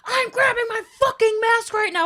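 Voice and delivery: high, loud mock-furious rant; hammed-up shrieky indignation; irate customer. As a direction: imitate an outraged customer telling off staff. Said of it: "And she goes".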